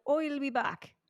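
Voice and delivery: Arnold Schwarzenegger. Acted. Co-host teaching by example, Irish-accented action star. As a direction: say OIL for I'll, Irish-style. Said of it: "if Arnold Schwarzenegger were to have done an Irish accent".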